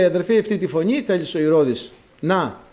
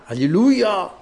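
mildly raised, drawn-out call; retold call, only lightly raised; imitated inner cry; 3 semitones higher, slower than usual. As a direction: act out a voice calling from within. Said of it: "κάποιος μέσα μου φώναζε".

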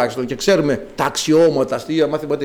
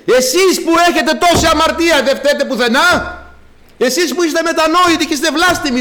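higher, louder accusing refrain; preacher rebuking the faithful, higher and louder; fiery preaching; 11 semitones higher, 4 dB louder than usual. Acted. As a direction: hurl each charge at the congregation. Rhetorical accusations at congregation.

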